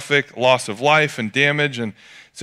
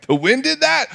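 high incredulous question; can't believe the wind did it; disbelief; 12 semitones higher than usual. Retold or imitated. Pastor retelling trampoline in fence, high disbelieving. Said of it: "And I'm like".

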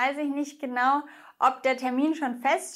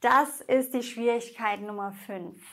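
ordinary talk

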